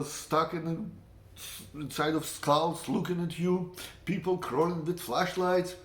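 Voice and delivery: normal talking voice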